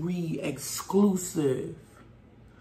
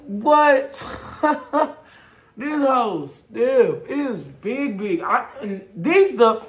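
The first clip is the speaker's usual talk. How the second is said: muffled behind fabric; talking through knit mask; 7 semitones higher, 7 dB louder, slower than usual. Real, not acted. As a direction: mouth covered then uncovered. Seen 1: Knit band covers mouth until about 1.3s, then pushed up.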